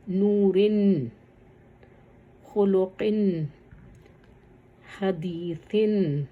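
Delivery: words drawn out slowly, ending on a clear "in" like نورن; slow kasra tanween. Shown as a demonstration. Teacher slowly sounding out kasra tanween examples. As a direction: stretch خلقن slowly and close on the n. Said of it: "کسرہ کی کچھ مثالیں".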